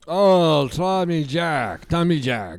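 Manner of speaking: gruff Eastern European puppet; Bloggy voice. Acted. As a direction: demand a cigarette in broken English.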